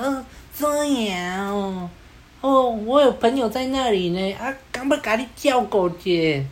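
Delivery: high-pitched mock Taiwanese Hokkien punk; bad Taiwanese accent. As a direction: imitate a wannabe gangster's clumsy Taiwanese, drawn out. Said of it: "结果台语又很不标准".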